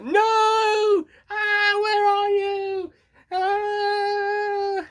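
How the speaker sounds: very high, loud, drawn-out scream; mock anguished wail. Imitating the guy who thinks his friend is dead, hammed-up wail. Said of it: "and he's like".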